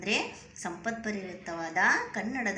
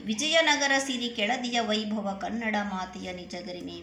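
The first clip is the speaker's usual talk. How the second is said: louder, rhythmic verse reading; poem recitation; 5 dB louder than usual. Acted. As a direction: proclaim the line to the class. Re-reads a poem line before explaining.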